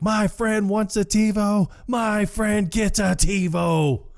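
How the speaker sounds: high-pitched movie battle cry; high, drawn-out declaration; mock hollering. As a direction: imitate a triumphant holler, slowing at the end. Acted cry from the jungle.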